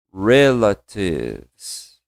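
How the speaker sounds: Relatives drawn out very slowly, re-la-tives; slow pronunciation demo. Shown as a demonstration. Teacher asks class to repeat Relatives, very slow model. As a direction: stretch Relatives syllable by syllable. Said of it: "Comigo, relatives".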